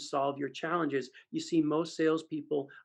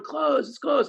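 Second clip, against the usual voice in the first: audience yelling; 11 semitones higher, 7 dB louder, slower than usual. Retold or imitated. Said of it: "They're all yelling".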